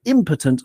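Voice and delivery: "impotent" with IM as the strong beat; stress on first syllable. Shown as a demonstration. Podcaster recapping syllable stress, first-syllable stress. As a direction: punch IM in "impotent". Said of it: "on the first syllable".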